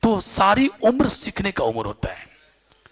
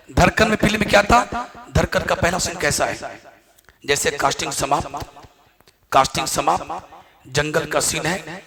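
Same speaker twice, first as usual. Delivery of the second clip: ordinary talk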